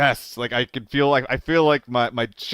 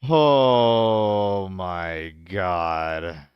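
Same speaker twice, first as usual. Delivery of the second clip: stunned slow oh my god; 4 semitones lower, much slower than usual. Real, not acted. Awe at raid haul.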